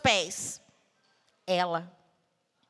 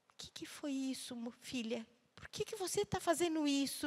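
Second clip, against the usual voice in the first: much softer, gentle questioning; soft mother; 3 semitones higher, 11 dB quieter than usual. Retold or imitated. Mother sitting on the bed asking her daughter, hushed and gentle. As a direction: ask it hushed, like a worried mother.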